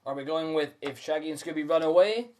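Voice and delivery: ordinary delivery, nothing marked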